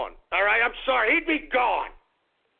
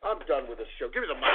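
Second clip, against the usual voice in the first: low mutter; 13 semitones lower, 7 dB quieter, much faster than usual. Acted. Host mutters, low and quiet.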